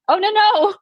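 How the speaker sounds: high, airy mock nonchalance; breezy dismissal. Imitating Rhys brushing off the worried court, airy and high. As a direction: toss it off like nothing's wrong.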